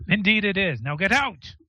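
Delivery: high-pitched, impatient order to leave; irritated dismissal. Acted. Wormwood ordering group out.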